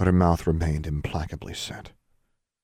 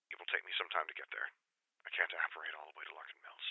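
faint, distant, quick telephone voice; very soft, brisk reply from far down the line; voice over the phone; 19 dB quieter, faster than usual. Acted. Sister on the phone agreeing to come, faint and quick.

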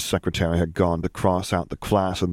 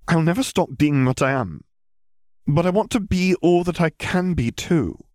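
put-on higher girl's voice, earnest; determined young Hermione; 10 semitones higher than usual. Girl pleading with her teacher, earnest and determined. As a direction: insist on who she is.

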